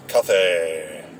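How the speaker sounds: "Cathay" said as kuh-THAY, stress on THAY; mispronounced Cathay. Retold or imitated. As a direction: say "Cathay" with a lisped th, stressed at the end. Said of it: "would always pronounce her name like".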